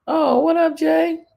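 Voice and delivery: high, loud mock greeting; sucking-up teacher. Teachers fawning over the principal, hammed-up greeting. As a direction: play the fawning follower saying hi.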